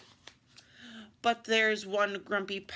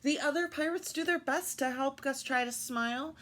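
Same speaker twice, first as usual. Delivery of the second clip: usual speaking voice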